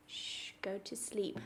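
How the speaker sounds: soft hushing whisper; hushed. Pretend. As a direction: shush a sleepy toy gently. Hushing a toy dinosaur to sleep.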